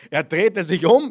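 loud, emphatic build to the climax; preacher building up to Paulus turning round, loud; preaching at full force. Acted. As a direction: drive it home with a burst of volume.

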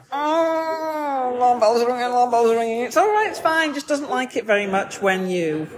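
hammed-up inner panic; inner alarm. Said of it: "and I'm like".

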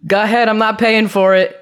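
high, insistent refusal; acted-out stubborn pushback; retold defiance. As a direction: dare them, voice lifted. Retold defiant answer to clerk.

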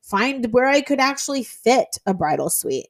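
ordinary talk

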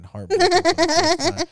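high, loud laughing words; laughing through it. Real, not acted. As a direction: laugh your way through the line. Laughing while retelling heartbreak.

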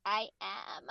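exaggerated creaky fried voice; vocal fry. Answering the vocal coach who said she fries, vocal fry. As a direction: let it crackle in a self-mocking fry. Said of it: "You're, you're frying your voice".

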